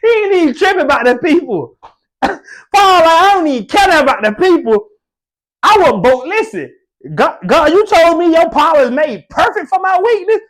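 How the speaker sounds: high, loud, near-tearful preacher's climax; preaching at full force. Acted. Preacher to congregation about Paul's boast, loud and high. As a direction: cry it out in joyful fervor.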